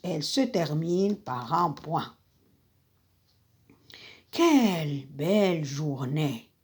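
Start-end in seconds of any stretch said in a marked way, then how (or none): 4.3-6.5 breathy whisper of Quelle belle journée, pitch dropped low; hushed wonder at a lovely day; whispered exclamation. Shown as a demonstration. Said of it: "c'est une phrase exclamative".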